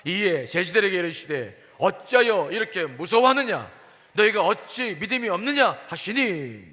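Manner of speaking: loud, projected Bible reading; scripture reading. A narrator's reading. Preacher reading the day's verse to congregation, loud scripture reading. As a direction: read the verse out forcefully to all.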